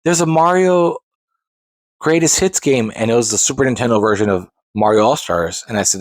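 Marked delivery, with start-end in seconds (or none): 0.0-2.9 higher, groping customer voice; unsure customer. Retold or imitated. Customer half-remembering a Mario title, high and groping. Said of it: "Or someone say".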